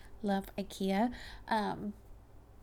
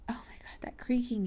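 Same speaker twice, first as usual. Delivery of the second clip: scared whisper; 2 semitones higher, faster than usual. Real, not acted. Scared by a creaking noise.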